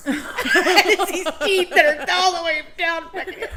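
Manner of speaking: high, loud words through laughter; laughing while imitating. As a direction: laugh through a mock vampire face. Imitating Peter's teeth, laughing.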